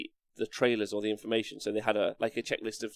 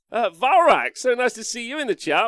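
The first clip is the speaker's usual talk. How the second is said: much higher, louder, excited hello; excited greeting; 11 semitones higher, 7 dB louder than usual. Real, not acted. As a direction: greet a friend with delight. Greeting a viewer in chat.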